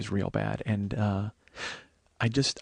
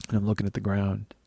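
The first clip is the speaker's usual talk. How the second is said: ordinary talk, nothing marked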